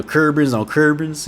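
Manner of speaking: "Curbans" with a heavy country "ur"; Memphis pronunciation. Shown as a demonstration. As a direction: lean on the r in both "Curbans". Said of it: "how they say things out there".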